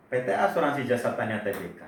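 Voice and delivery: ordinary talk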